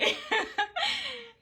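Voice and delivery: squeaky high laugh; high giggle burst. Real, not acted. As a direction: break into giggles. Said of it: "That's not funny".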